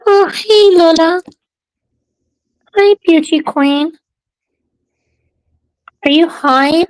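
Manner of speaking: high sing-song pet voice. Real, not acted. Cooing to her cat.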